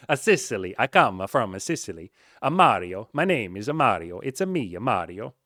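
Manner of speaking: loud, hammed-up cartoon Italian accent; Mario Italian accent. Host shows off his Italian impression to listeners, in a loud cartoon Italian accent. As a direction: ham it up like Mario. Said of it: "Italian impression you've ever heard".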